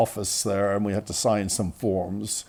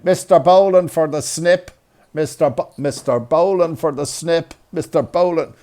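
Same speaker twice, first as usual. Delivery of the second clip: porter calling out; 5 semitones higher, 6 dB louder than usual. Retold or imitated. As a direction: bellow a patient's name across the ward. Porter calling out patient's name on ward.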